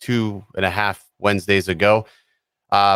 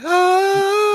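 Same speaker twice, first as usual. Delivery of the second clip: very high sung angelic ah; gospel choir ah; 3 dB louder than usual. Retold or imitated. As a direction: sing it like the gospel music. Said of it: "the gospel music. It's like".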